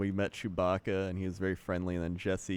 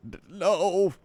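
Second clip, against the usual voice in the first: imitated kid's denial; 9 semitones higher, 5 dB louder than usual. Kids denying Vader's accusation.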